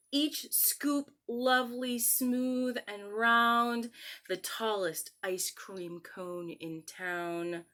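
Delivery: savouring each flavour like a treat; poem reading. Acted. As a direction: read the poem slowly, lingering on the flavours. Reads a poem aloud.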